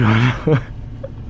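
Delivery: sudden laugh breaking off the sentence. Real, not acted. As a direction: burst out laughing mid-thought.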